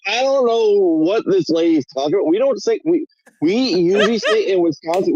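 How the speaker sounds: ordinary talk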